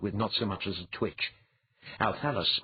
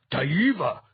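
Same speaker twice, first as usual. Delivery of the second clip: loud, high startled exclamation; shocked gasp; 8 semitones higher, 7 dB louder than usual. A narrator's reading. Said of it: "the youthful priest gasped".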